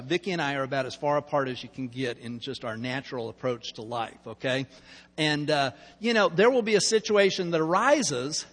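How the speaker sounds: normal talking voice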